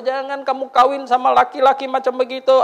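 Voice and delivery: high, loud stern parent warning; parents forbidding. Retold or imitated. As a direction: play the disapproving parents forbidding the marriage. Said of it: "orang tua bilang".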